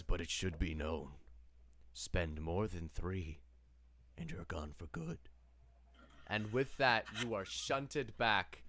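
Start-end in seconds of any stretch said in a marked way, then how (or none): 0.0-5.2 Death's voice. Acted. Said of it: "he says".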